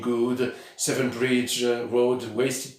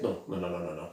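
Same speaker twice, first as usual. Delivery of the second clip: much softer and lower string of quick non non non; quiet self-correction; 7 semitones lower, 11 dB quieter, faster than usual. Real, not acted. Collector correcting his wrong album title, dropping to a quiet mumble. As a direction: catch your own mistake under your breath, fading away.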